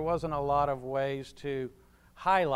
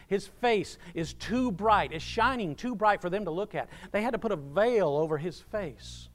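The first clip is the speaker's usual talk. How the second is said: ordinary delivery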